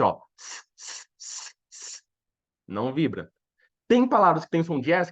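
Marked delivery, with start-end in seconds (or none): 0.4-2.0 "S, s" as a soft, voiceless hiss; S sound. Shown as a demonstration. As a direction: demonstrate "S, s" with no vibration in the throat. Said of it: "que tem esse som de S".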